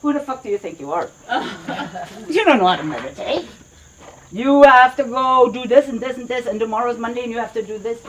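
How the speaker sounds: nagging monkey mind. Acted. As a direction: rattle it off like a restless mind attacking. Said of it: "the monkey is like".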